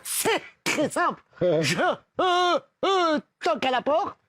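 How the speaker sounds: stammering on each syllable; stammered repetitions; stutter. Acted. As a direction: trip over the words like the stutterer. Stutterer explains his method.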